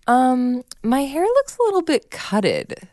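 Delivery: put-on high, slow small-child voice; sheepish little girl. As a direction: confess it like a guilty toddler. Mom voicing daughter's confession.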